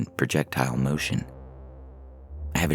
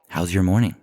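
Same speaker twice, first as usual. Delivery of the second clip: lightly voiced cheerful colleague; 5 semitones higher, 5 dB louder than usual. Miss Welch asks Tom, smiling.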